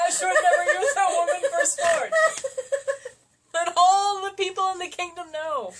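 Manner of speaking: loud, high herald with a scroll; town crier. Pretend. Mocking the narration as a herald reading a scroll, loud. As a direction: announce it to the whole kingdom. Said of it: "someone holding a scroll".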